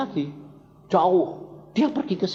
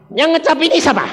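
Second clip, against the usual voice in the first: higher and louder, pressing the officer; pointed challenge out loud; confronting question; 11 semitones higher, 5 dB louder, faster than usual. Retold or imitated. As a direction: put the question to the policeman boldly. He repeats it aloud to police.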